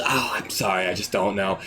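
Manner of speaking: low, insincere clerk's apology; feigned regret to a customer; fake apology. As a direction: drop low and pretend to be sorry. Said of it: "you're just lying".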